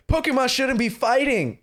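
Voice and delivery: high-pitched mock pleading; Nurse Joy. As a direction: protest like a distressed nurse. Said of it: "Nurse Joy's like".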